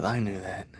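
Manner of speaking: mild mock deadpan from the old guy; lightly voiced old guy. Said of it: "He goes".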